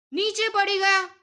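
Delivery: usual speaking voice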